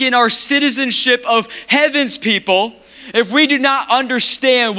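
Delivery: high, driving pulpit voice; impassioned preaching. Real, not acted. Preacher recalls last week's ambassadors message, impassioned preaching.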